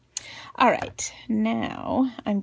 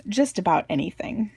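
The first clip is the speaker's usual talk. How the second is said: ordinary talk, nothing marked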